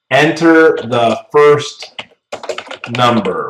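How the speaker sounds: drawn out slowly as if typing each word; slow dictation of a prompt string. Shown as a demonstration. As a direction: spell it out slowly while typing it in. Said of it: "I'm gonna use my input parameter".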